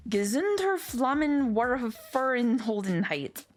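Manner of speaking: slow, careful German-ish name; slow full name. Acted. Wife reluctantly reciting her absurd full name, slow and careful. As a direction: spell it out slowly and grandly.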